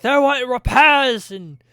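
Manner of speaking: dwarf hollering back, slow; loud reply. Acted. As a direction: shout the answer over the noise. Dwarf answers brother loudly.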